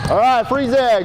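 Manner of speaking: loud, high call to freeze; calling stop. Real, not acted. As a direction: stop everyone mid-drill. Stopping the drill.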